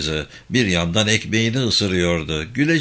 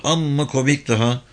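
plain reading voice

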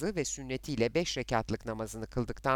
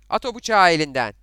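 loud, harsh command; stern shouted order; 3 semitones higher, 13 dB louder than usual. Acted. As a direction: bark it as an urgent order. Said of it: "Sert bir sesle". (sert bir sesle bağırarak emir)

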